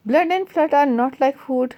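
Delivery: slow, deliberate recitation; measured classroom poem reading. Acted. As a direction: read the verse line slowly for the class. Reading the poem line aloud.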